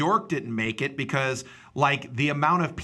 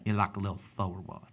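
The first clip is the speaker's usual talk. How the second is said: Southern accent; 5 semitones lower, 6 dB quieter than usual. Shown as a demonstration. Replying to co-host who likes it, soft Southern accent. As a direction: answer in a Georgia accent. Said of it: "I like that kind of Southern accent".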